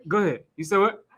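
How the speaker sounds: normal talking voice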